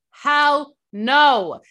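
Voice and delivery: loud, high hell no; real outrage; emphatic refusal. Real, not acted. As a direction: slam it down like a door. Ranting about the man.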